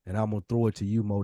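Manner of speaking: ordinary talk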